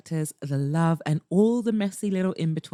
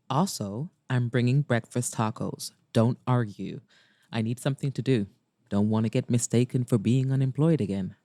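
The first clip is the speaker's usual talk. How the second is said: Maxwell; 8 semitones lower than usual. Acted. Maxwell texts about breakfast tacos, low voice. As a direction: distinctly lower man's character voice. Maxwell's text, read in character.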